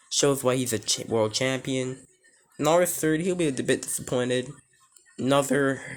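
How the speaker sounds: ordinary talk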